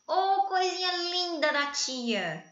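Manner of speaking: put-on teacher's sarcastic baby talk to a student; ironic sweetness. Shown as a demonstration. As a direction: coo at a pupil with obvious sarcasm. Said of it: "olha o exemplo de ironia".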